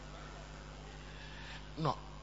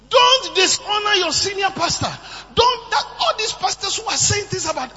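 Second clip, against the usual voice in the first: loud, higher preaching; preaching shout; 10 semitones higher, 9 dB louder than usual. Real, not acted. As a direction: warn the church with force. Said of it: "preaching at full force".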